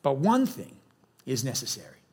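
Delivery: measured, low scripture reading. Acted. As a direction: read the verse aloud with solemn pauses. Reads scripture aloud to congregation.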